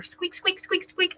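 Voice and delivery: quick repeated squeaks in a high voice; squeaky toy imitation. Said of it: "makes a little squeaky noise".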